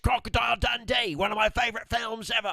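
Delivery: hammed-up Australian accent, high and loud. Husband hams up an Australian greeting to listeners, high and loud. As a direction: greet the Aussie listeners like a showman.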